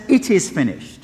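firm, settled declaration leaning on It is; triumphant statement. Retold or imitated. Preacher voices Jesus's final word as calm triumph. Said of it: "No, he says".

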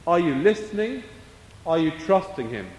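ordinary talk, nothing marked

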